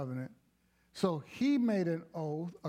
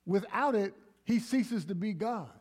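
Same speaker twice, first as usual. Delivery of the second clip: preaching at full force; 5 semitones higher than usual. Acted. Preaching builds.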